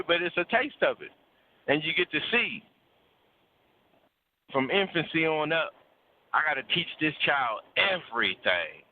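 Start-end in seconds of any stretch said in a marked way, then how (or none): none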